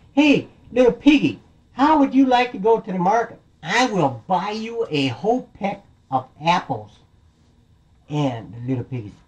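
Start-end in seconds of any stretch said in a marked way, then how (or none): 0.1-7.0 high, loud calling-out voice; coaxing big bad wolf. Wolf luring the piggy to market, high coaxing call. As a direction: call out a tempting offer.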